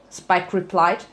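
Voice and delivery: ordinary delivery, nothing marked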